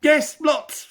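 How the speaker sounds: very high, loud blurt; panicked hobbit. Acted. Bilbo blurts to the trolls, panicked.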